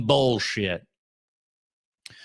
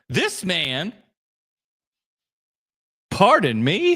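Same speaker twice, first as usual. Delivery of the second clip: quicker offended repeat; indignant repeat; mock offended; slower than usual. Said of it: "My word".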